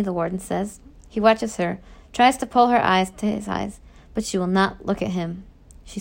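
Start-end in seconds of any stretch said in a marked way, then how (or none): none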